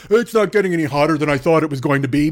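dumb classmate voice. Retold or imitated. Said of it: "will sit there and go".

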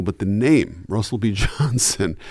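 ordinary talk

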